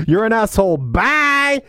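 high mock-cheeky send-off; acted dismissal. Maurice brushes off the priest, high-pitched dismissive farewell. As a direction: toss off the insult, pitch leaping on the last word.

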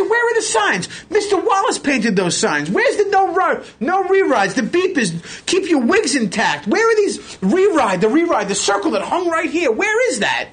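high-pitched, outraged demanding; high agitated New-York-style complaint; indignant rant. Retold or imitated. Retelling his angry rant.

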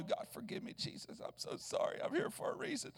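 hushed, breathy-free whisper of a prayer; soft confessional whisper. Retold or imitated. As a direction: whisper an apology to God.

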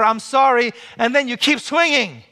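high, loud, pressing on; overeager apology. Shown as a demonstration. Preacher mocking one who keeps swinging after apologizing, high and insistent.

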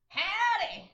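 high, loud put-on howdy; hammed-up yelled greeting; weird scream. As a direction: holler it like a cartoon cowboy. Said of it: "you screaming in a weird way".

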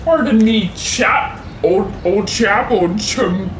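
hammed-up posh British address; posh English. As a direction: play an overly polite Englishman. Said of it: "polite and English-y".

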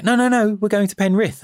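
high, loud mock protest; protesting girlfriend. Girlfriend corrects the conductor about Penrith, high imitated protest. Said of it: "my girlfriend was like".

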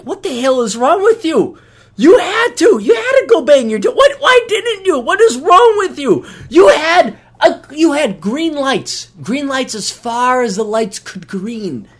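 scolding shout. Retold or imitated. Every guy yelling at the buddy who said no, loud and high. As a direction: yell at your buddy what is wrong with you.

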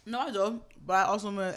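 ordinary delivery

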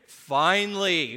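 "Finally" loud and drawn out; relieved emphasis. Acted. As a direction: stretch "Finally" as if relief has come at last. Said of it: "a sigh of relief amongst the crowd".

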